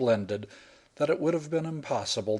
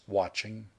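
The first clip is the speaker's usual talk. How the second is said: ordinary delivery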